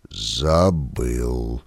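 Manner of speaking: low, soft sepulchral voice; wraith. A narrator's reading. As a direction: speak from beyond the grave. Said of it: "ответил загробным голосом Каргинс".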